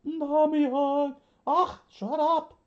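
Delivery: put-on squeaky high-pitched girl, loud and annoyed; loud high teasing girl. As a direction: snap it out in a high cartoon girl's voice. Voiced character banter.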